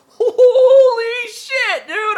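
dragged-out, high, loud laughing squeal; ecstatic shriek. Retold or imitated. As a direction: scream with pure delight. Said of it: "I would be like".

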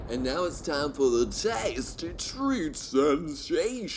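high, loud, slow segment announcement; hammed-up announcer. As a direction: draw out the segment title like a showy announcer.

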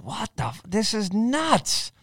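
slow, amazed disbelief; hushed, awestruck mutter; stunned disbelief. Retold or imitated. Stunned reaction quoted with "I was like.

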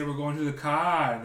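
high, loud, slow mock Kiwi accent. Mimicking how New Zealanders say it, mock Kiwi accent. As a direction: drag out the vowels like a hammed-up New Zealander. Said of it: "They say".

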